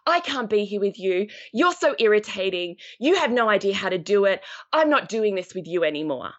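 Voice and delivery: fed-up, emphatic scolding; exasperated parent. Acted. Parent snapping at child over the reader, exasperated. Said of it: "walloped her on and went".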